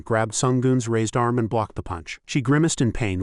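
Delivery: plain reading voice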